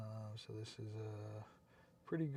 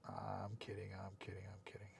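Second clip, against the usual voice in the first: fading teasing; 4 semitones lower, 7 dB quieter than usual. Real, not acted. Teasing a chatter, repeating.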